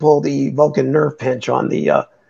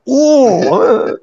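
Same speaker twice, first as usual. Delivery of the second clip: high mock wince; 9 semitones higher, 4 dB louder than usual.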